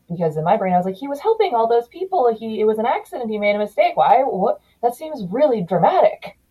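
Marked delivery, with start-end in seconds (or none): 1.0-6.4 higher-pitched, mildly acted bewilderment; uncomprehending child. Recalling childhood reaction to the officer's suicide, mock bewildered. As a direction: replay a kid's puzzled protest in a higher voice. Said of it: "I just didn't understand why".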